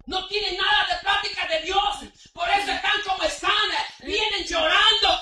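loud, high, driving sermon; preaching at full force. Acted. Preacher rebuking lukewarm believers, full-force preaching. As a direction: exhort them to come to church.

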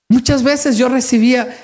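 ordinary delivery

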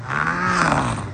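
ordinary delivery

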